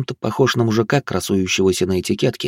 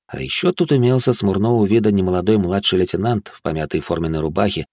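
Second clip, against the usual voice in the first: usual speaking voice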